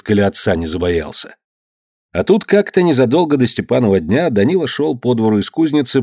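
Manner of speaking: plain reading voice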